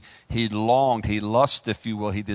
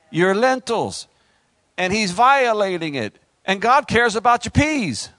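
high, loud pulpit preaching; fiery preacher building up; preaching at full force; 8 semitones higher, 5 dB louder than usual. Acted. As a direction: drive it home to the congregation. Pastor preaching on the peas.

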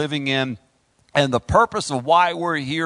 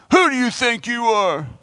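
higher, indignant challenge from the pews; resentful congregation; 5 semitones higher than usual. Retold or imitated. Congregation challenging the preacher's authority, indignant and higher. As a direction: voice the offended churchgoer pushing back. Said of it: "I can feel the resistance from here".

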